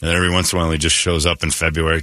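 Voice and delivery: ordinary delivery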